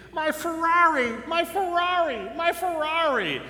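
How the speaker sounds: high, hammed-up wail of loss. Injured man at crash scene cries for his Ferrari, hammed-up wail. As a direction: cry it out like a man mourning his car. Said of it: "he's screaming and he's crying".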